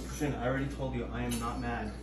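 soft, fast, tight denial; passive-aggressive. Acted. Girlfriend telling boyfriend she's not mad, passive-aggressive and soft. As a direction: insist you're fine while clearly mad.

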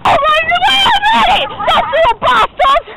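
high, loud yell after runaway ducks; shouting at ducks. Pretend. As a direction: scream at them to run away. Yelling at fleeing ducks.

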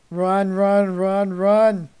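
loud, urgent chant; panicked urging. Real, not acted. Player fleeing angry cows, panicked shouting. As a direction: shout it on the run.

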